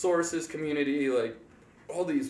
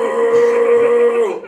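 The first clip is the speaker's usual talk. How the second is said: very loud, high, sustained roar; imitated Bigfoot-like bellow; 9 semitones higher, 15 dB louder than usual. Imitating the guy behind them at the concert, long beastly bellow. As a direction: howl one long note like a beast. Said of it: "sounded like Bigfoot's cousin or something".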